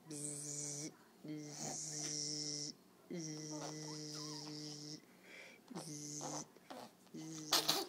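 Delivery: low, soft, sustained buzz; bumblebee buzzing. Pretend. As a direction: imitate a bee closing in on a child. Plays a bumblebee coming.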